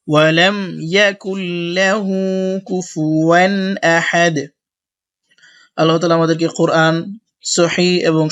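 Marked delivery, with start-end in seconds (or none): 0.1-4.4 slow, melodic Quran recitation; tajweed recital, drawn out; slow full recitation. Shown as a demonstration. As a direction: recite the whole verse so they follow. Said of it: "আমরা একটা সম্পূর্ণ একসাথে বলি".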